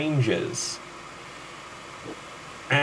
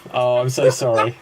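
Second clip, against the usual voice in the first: ordinary talk, nothing marked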